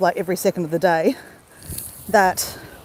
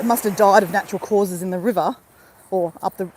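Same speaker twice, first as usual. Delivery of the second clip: ordinary talk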